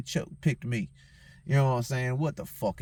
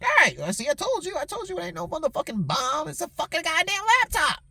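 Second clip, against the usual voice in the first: retold angry outburst; 14 semitones higher than usual. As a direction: replay the blow-up at security, pitch climbing. Angry retort to airport security.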